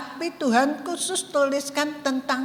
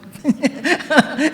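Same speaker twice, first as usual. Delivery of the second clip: laughing; 3 dB louder than usual. Real, not acted. Laughing at her own story.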